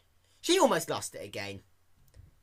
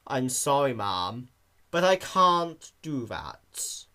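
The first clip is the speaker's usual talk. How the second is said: slow bored clerk; 4 dB louder, slower than usual. Acted.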